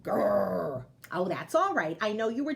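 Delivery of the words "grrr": bear growl. Acted.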